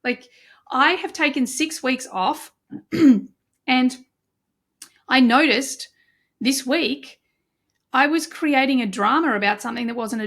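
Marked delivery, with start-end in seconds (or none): none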